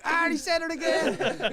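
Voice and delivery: high, loud, slow laughing mock of the other hosts. Mimicking podcast mates teasing him, laughing. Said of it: "they'd be like".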